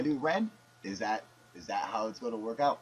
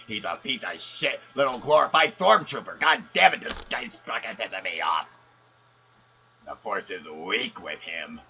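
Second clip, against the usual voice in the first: louder, snarling rant; mock angry rant; 3 semitones lower, 5 dB louder than usual. Caller rants about Kylo Ren.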